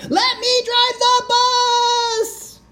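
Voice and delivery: very high, slow shrieking wail; full toddler tantrum; pigeon tantrum. Acted.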